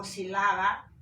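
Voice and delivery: sílaba stressed on middle LA, si-LA-ba; wrong stress. Shown as a demonstration. Teacher showing a wrong way, sílaba stressed on LA. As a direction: push the LA in sílaba. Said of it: "yo no digo sílaba o sílaba".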